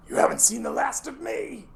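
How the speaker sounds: high, theatrical villain threat; sneering cartoon villain escaping; mustache twirling villain. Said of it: "you hear a voice say".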